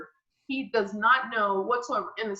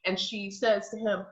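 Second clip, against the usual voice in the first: ordinary talk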